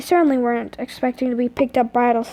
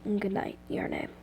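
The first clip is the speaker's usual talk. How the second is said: hushed, tender good night; quiet bedtime murmur; soft good night; 4 semitones lower, 14 dB quieter than usual. A narrator's reading. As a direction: murmur it as lights go out. Anti says good night softly.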